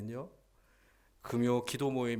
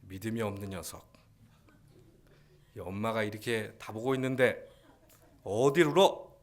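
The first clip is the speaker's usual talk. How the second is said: mock scolding; 6 dB louder than usual. Preacher as mother wrongly scolding her crying baby, mock-stern. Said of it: "갓난아이를 들고 책망합니까".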